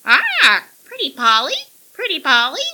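high, loud squawking parrot; shrill parrot cry. A narrator's reading. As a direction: screech it like a parrot. Parrot greets her.

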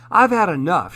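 a fed-up bird's squawk, lightly done; mild squawk. A narrator's reading. Fed-up pheasant to her friend Hedgehog, mild squawk. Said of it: "she squawked to her friend".

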